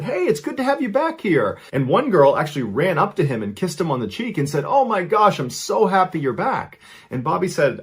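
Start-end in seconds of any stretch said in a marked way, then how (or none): 0.0-1.6 high mock holler of welcome; acted friendly yell. A narrator's reading. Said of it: "yelled out to him and said". 4.6-6.8 high-pitched, gushing delight; acted happy squeal of welcome; excited greeting. A narrator's reading. Girl greets him with a kiss.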